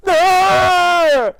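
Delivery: sudden high, loud imitated scream; horror-movie scream. Bloodied woman shrieking at the rookie cop, screaming. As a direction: let out a long, piercing mock shriek.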